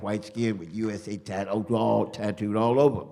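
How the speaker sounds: rhythmic anti-war declamation, building in volume; poem recital. Acted. Poet on a chair before a stopped crowd, reciting. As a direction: start punchy, ease back, then drive to a loud peak. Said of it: "So I went on to recite this poem".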